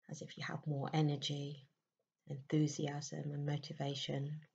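soft, unhurried meditation voice with long pauses; guided meditation. Acted. Leading listeners into a May meditation, soft and soothing.

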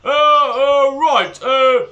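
loud, high, stammering headmaster; stammer, lost for words; flustered headmaster. Acted.

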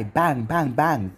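ordinary delivery

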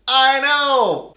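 loud, high I know!; loud and high, fed up with cheesy dubbing; exasperated outburst. Real, not acted. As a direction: burst out in exasperation. Exasperated over phony dubbing.

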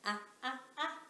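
repeated short ah; mild pretend alarm; ah sound. Shown as a demonstration. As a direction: say ah ah ah like the startled girl. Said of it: "pretend ants are crawling on her arm".

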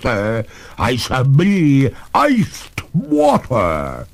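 high, loud, drawn-out threat; threatening cry. A narrator's reading. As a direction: ghost lady vowing revenge, slowing at the end. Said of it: "cried the lady threateningly".

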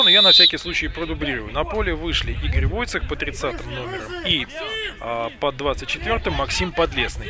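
usual speaking voice

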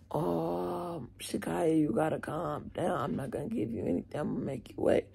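very soft, hushed placating voice; imitating calm staffer. Staff member making angry student wait, hushed calm imitation. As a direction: talk her down very softly. Staffer calming her down, retold.

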